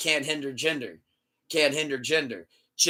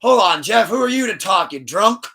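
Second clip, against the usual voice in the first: loud, higher mock heckle; mock heckling; 6 semitones higher, 9 dB louder than usual. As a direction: jeer like angry fans online. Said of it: "people were like".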